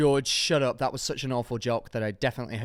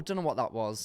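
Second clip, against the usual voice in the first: ordinary talk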